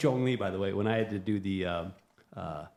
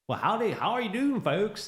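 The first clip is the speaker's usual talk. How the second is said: Southern accent; 13 semitones higher, 7 dB louder than usual. Shown as a demonstration. Midwesterner mocking the Southern accent for fun, exaggerated Southern twang. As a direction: put on a big, not very good Southern accent. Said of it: "like to do the Southern accent".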